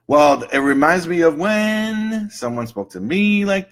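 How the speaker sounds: high, slow, drawn-out recollection; client remembering. Acted. Client traces anger back to a memory, slowly remembering. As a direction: stretch it out as the memory surfaces. Acted client recalling old hurt.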